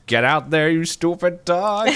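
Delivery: mock angry shout. Grouchy owner orders his dog out in the cold, mock angry shout. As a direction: yell it like a grouchy cartoon character bossing the dog.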